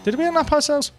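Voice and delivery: high, astonished question; surprised. Real, not acted. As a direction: squeal it in disbelief. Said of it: "repeated question".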